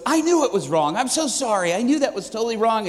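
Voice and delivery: mock panicked apology, pitched high; flustered apology. Young pastor apologizing to waitress, flustered. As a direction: blurt a high, hurried retraction.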